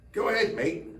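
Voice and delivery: high, badly faked British accent; high-pitched mock Brit giving the go-ahead. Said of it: "Bad accent".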